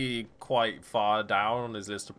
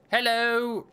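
cheery loud hello; 11 semitones higher, 5 dB louder than usual. Real, not acted. Greeting game character loudly.

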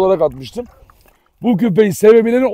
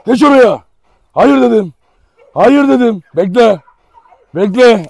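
raised, insistent orders; shouted commands; 3 semitones higher, 5 dB louder, slower than usual. Real, not acted. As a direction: drill the dog with loud orders. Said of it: "Bağıra çağıra". (bağırarak komut verme)

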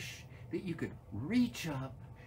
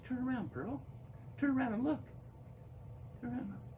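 very high, sweet pet talk; coaxing a pet; 9 semitones higher, 6 dB quieter, faster than usual. Real, not acted. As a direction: coo softly to a shy little animal. Coaxes pet squirrel to come out.

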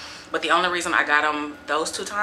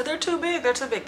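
mocking critics; 9 semitones higher than usual. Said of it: "that are gonna say".

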